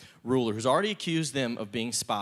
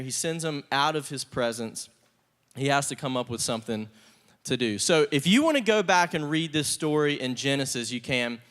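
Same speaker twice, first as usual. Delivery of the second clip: ordinary talk, nothing marked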